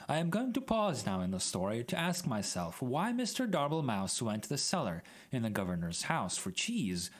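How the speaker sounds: plain reading voice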